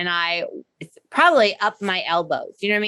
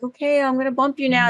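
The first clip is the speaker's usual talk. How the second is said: higher, knowing, announcing tone; mock warning to dog; 5 semitones higher than usual. Owner telegraphing the bump to the dog, mock announcing. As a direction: tip off the dog like you're in on it.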